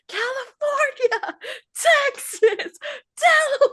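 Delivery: high, drawn-out imitation of a hero's shout; mock battle cry. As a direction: belt each name like an attack move. Acting out hero's attack shouts.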